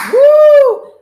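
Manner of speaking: high, loud whoop of joy; cheering woo. Real, not acted. Host cheers the finished mantra with a loud whoop. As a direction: let out a big celebratory whoop.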